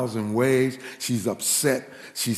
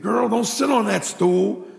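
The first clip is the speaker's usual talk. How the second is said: urgent warning; 10 semitones higher, 5 dB louder than usual. Retold or imitated. Friend calls out a warning.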